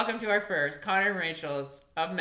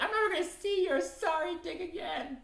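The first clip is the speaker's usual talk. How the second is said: mock breakup line; 12 semitones higher than usual. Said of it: "I'm like".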